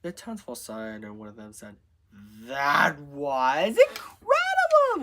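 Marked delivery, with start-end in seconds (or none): 2.1-5.0 amazed cheer. A narrator's reading. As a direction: draw it out in loud amazement. Amazed townsperson in the story.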